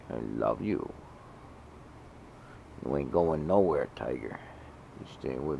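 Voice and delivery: low, soft I love you; tender. Real, not acted. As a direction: murmur it close to the cat. Soft words to her cat.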